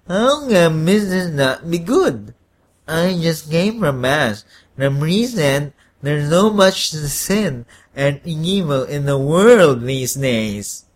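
slow, congested mumble; stuffy-nosed protest. A narrator's reading. Congested man questions the devil about sin, stuffy-nosed. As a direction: argue through a blocked nose. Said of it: "with a stuffy nose".

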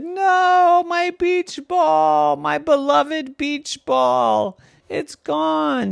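high, slow, wailing lament for the lost ball; wailing over his beach ball. Acted. Boy mourning his stolen beach ball, high and wailing. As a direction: cry out like a heartbroken little boy. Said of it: "And he started crying".

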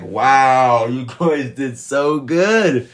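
awed praise. Retold or imitated. Viewer praising the cast in awe, stretched out. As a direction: gush at the actors in wonder. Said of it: "So I'm just sitting there like".

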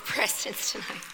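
ordinary delivery

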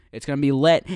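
loud hype. Acted. Hyping the new podcast.

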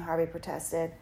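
ordinary delivery

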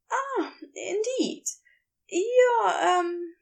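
high, faltering halt; hesitant Aziraphale. Acted. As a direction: lose the thread, uncertain. Aziraphale unsure.

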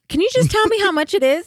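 high, exasperated plea. Retold or imitated. Customer losing patience with the cashier, high and pleading. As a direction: push the question up high and pleading. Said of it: "And I'm like".

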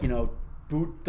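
normal talking voice

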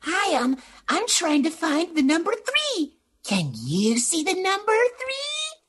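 put-on high, slow, sweet kids' TV voice; kids' show host. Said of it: "the cute little".

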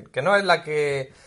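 ordinary delivery

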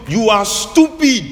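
high, loud, slow insult; the other person's high, loud sneering insult. Retold or imitated. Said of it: "the person goes".

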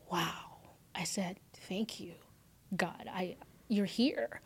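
very soft, near-whispered thanks; slow, reverent murmur to God; hushed awe. Retold or imitated. Woman alone in car thanking God, hushed and awed.

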